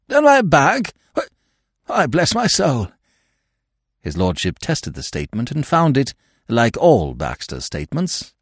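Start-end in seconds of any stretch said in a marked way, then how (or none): 0.1-2.9 high, startled exclamation; astonished. Acted. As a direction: burst out in surprise. Astonished discovery.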